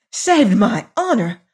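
indignant outburst with jumps in pitch; furious cry. A narrator's reading. Lady answering Hagar's claim, indignant furious cry. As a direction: throw the question back in outrage. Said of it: "cried Missus Delamere furiously".

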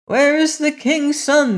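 very high, searching call. A narrator's reading. Crowd searches the dungeon for the prince, calling out. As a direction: call it high, pitch held up. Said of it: "they called out".

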